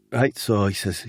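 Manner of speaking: ordinary talk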